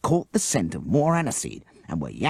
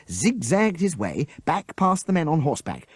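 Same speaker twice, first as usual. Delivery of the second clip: usual speaking voice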